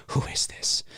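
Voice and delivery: breathless frightened whisper; scared whisper. Retold or imitated. Sam on the second call, whispering in fear. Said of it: "she does get scared".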